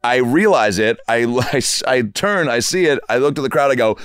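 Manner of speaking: ordinary talk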